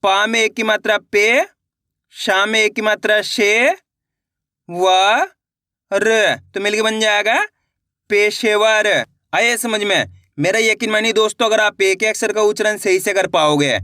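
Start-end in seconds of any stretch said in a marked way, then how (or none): 0.0-8.9 slow, clear sounding-out for learners; syllable-by-syllable reading demo. Shown as a demonstration. Teacher showing students how to blend syllables, slow and clear. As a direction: sound out each syllable, then blend into the whole word. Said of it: "चलिए एक बार फिर से देखिए".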